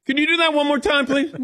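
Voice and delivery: high-pitched, quick eager request; fascinated passenger. Acted. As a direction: ask like an over-keen pupil. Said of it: "I'm just fascinated".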